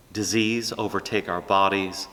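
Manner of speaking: ordinary delivery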